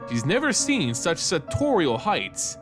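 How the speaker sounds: usual speaking voice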